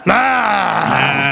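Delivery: gruff shouted ah; gravelly shout. Retold or imitated. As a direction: grunt it rough and gravelly. Imitating Loggia's gravelly shout.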